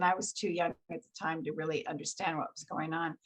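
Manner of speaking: ordinary delivery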